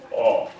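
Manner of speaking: aw rounded and darker; British aw. Shown as a demonstration. Teacher demonstrating the British aw vowel. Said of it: "ah becomes aw".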